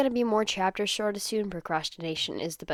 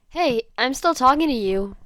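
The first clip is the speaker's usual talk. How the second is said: louder, higher indignant huff; huffy; 5 semitones higher, 9 dB louder than usual. Acted. Zenitsu huffs at his ignoring boyfriend. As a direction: act put out at being ignored. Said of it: "he huffs".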